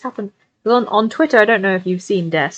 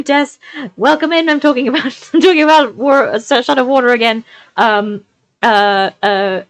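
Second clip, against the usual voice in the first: usual speaking voice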